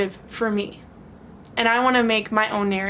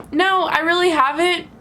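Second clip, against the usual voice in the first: firm comeback; 6 semitones higher, 4 dB louder than usual. Retold or imitated. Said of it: "just say".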